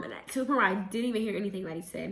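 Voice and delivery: ordinary delivery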